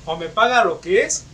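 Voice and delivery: loud, heated telling-off of a customer; an angry customer giving the cashier an earful; angry rant. Pretend. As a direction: chew her out, loud and fed up. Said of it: "yo sí le hubiera dicho".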